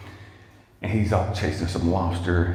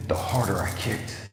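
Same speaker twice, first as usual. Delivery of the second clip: ordinary talk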